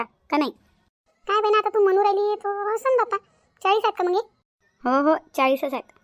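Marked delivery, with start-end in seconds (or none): 1.3-4.2 put-on higher, querulous old woman voice; confused old aunt. Aunt asking niece if it is forty, higher confused voice. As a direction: puzzle over it like a muddled aunt.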